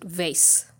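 vase said as "vayss"; VASE rhyming with base. Shown as a demonstration. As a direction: make vase rhyme with base, ending in S. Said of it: "like base".

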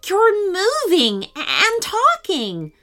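high, slow amazement; astonished. A narrator's reading. Sean sees his teddy move and talk, astonished. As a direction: marvel at it, drawing each word out. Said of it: "said Sean with a gasp".

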